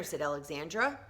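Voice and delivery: plain reading voice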